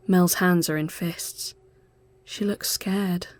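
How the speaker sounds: plain reading voice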